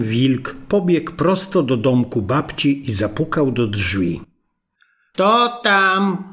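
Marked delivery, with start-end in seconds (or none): 5.1-6.2 put-on high, louder call of an old grandmother; grandma calls out to the knock, voice pitched high; old woman asking. Grandmother answering the knock, in a high old-woman call. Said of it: "zapytała staruszka".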